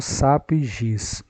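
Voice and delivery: ordinary talk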